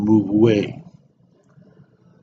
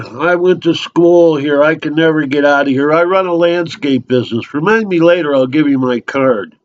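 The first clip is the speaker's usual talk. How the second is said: higher-pitched, rambling bar regular; talkative local barfly; 5 semitones higher than usual. Acted. Local unloading his life on a trapped drinker, eager and chatty. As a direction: pour out your life story unasked.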